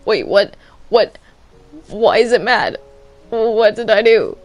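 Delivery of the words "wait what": ordinary talk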